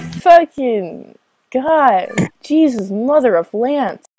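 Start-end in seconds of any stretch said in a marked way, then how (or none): none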